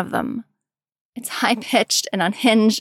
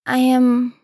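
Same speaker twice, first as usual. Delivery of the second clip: careful, halting admission, pitched up at first; slow and careful; 3 semitones higher, 4 dB louder, slower than usual. A narrator's reading. Tim admits worry to Jason, slow and careful. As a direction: set each word down with care. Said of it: "slow and careful".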